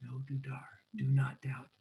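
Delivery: hushed voice in the head; Adolfo. Retold or imitated. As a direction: whisper it like a remembered warning. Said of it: "I hear Adolfo in my head".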